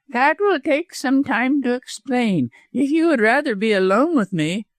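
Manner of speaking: high-pitched sly villain; mean lawyer, insinuating. A narrator's reading.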